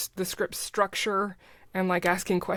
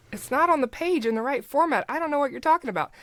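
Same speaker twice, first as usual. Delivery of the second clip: higher, fast mock editor complaint; editor's objection; 8 semitones higher than usual. Retelling the editor arguing about script format, high and fast. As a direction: imitate the fussy editor, quick and high. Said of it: "he was like".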